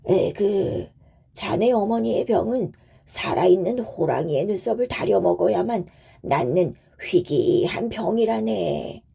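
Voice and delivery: put-on troubled old-fashioned physician voice; village doctor, regretful. Doctor tells son of the impossible remedy, regretful. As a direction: sigh out the hopeless diagnosis as the doctor.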